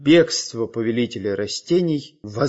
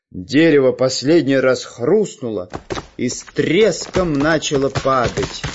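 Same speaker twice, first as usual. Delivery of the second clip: usual speaking voice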